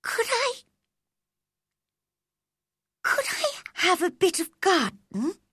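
put-on high, hesitant girl's plea with a halting restart; slightly shaking voice. A narrator's reading. Girl nervously asking her guardian for some garden, slightly shaking voice. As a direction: ask timidly, pitched well up. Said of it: "her voice shook a little".

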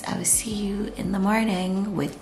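soft whispered sign-off; whispering good night. Real, not acted. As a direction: whisper it, winding down for bed. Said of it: "with my scratchy voice".

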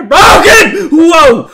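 very high, loud drawn-out yell; startled scream. Real, not acted. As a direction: shriek the word as the scare hits. Sudden scare mid-sentence.

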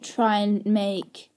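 very drawn-out reprimand; slow scolding. Acted. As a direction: spell out the warning slowly.

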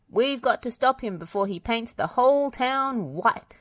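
high, pressing urgency; urging. A narrator's reading. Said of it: "urged Bob".

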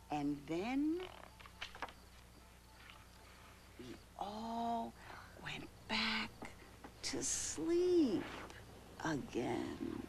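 very soft and slow, long pauses; hushed, sleepy ending. Acted. Mother finishes the bedtime rhyme, hushed and slow. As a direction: let it sink away towards sleep. Story ends going to sleep.

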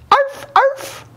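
very high yappy barks; barking. A narrator's reading. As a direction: yap like a tiny dog. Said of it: "barked Jujubee".

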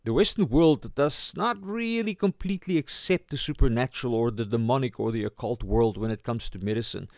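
ordinary talk

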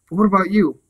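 high, loud worried plea; anxious for her safety; alarmed. Acted. Crow fearing for the mouse as the cat nears, alarmed.

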